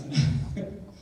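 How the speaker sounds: short breathy laugh; quick amused chuckle at yourself; brief laugh before moving on. Real, not acted. Laughs at own joke.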